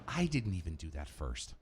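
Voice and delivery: low, quiet grumble; hushed, low mock protest; Frenchie. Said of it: "Somewhere Frenchie's going".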